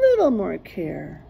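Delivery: ordinary delivery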